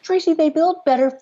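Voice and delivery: high, worked-up disbelief; incredulous. Real, not acted. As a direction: protest that it's only sticks. Incredulous argument about the cabin.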